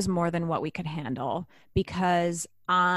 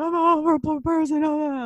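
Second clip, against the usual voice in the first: high, loud mock wail; hammed-up sobbing self-pity; mock crying; 9 semitones higher, 6 dB louder than usual. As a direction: imitate yourself bawling down the phone. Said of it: "like, crying and I'm like".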